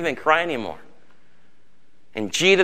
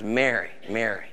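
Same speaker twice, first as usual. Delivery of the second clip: tender calling; 6 semitones lower, 7 dB quieter than usual. Acted. Jesus calling Mary by name.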